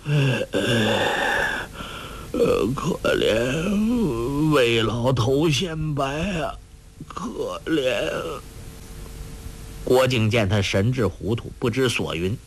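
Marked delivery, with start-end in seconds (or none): 0.0-8.1 put-on slow, drawn-out old man's sighs; sighing lament. A narrator's reading. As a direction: lament deliriously, groaning between the words. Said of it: "周伯通叹道".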